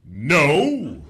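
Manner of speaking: loud, drawn-out heroic refusal; superhero. Acted. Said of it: "my impression of a superhero".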